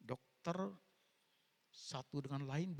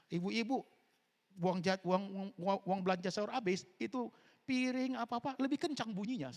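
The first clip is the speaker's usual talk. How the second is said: higher and louder, teasing the housewives; playful raised voice at the ladies; mock scolding; 8 semitones higher, 5 dB louder than usual. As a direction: ham up the angry kitchen clatter. Mocks housewives banging plates.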